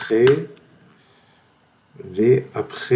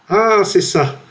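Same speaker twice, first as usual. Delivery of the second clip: much higher, louder burst of triumph; excited discovery; 10 semitones higher, 4 dB louder, slower than usual. Real, not acted. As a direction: cry out as the answer finally clicks. Finds the word in guessing game. (joie soudaine, bien plus aigu et fort)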